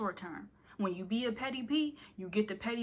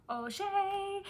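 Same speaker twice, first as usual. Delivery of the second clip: playful high call; 11 semitones higher than usual. Pretend.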